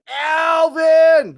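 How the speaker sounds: loud, high holler with the name held out; big drawn-out shout-out; shouted name greeting. Real, not acted. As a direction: holler the name in delighted welcome. Calling out a viewer's name.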